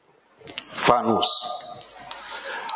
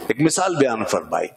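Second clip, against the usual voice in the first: ordinary talk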